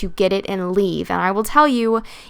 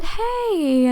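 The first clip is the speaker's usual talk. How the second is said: soothing; 11 semitones higher than usual. Retold or imitated. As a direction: coax him down, pitched way up and gentle. Calming a raging man.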